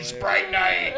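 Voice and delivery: a laughing, hammed-up yell; retold scream. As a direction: shout the party name while laughing. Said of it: "him screaming".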